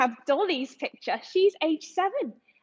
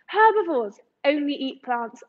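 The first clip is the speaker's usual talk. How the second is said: usual speaking voice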